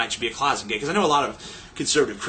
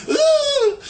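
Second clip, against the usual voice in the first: high, loud squealed whoop; party-girl whoop; 7 dB louder than usual. Retold or imitated. Imitated girl gives in, whoops.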